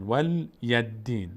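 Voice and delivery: ولی دین said "wal-yad-diin"; mistaken doubled dal. Shown as a demonstration. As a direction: vowelless l, then a heavy double d in ولی دین.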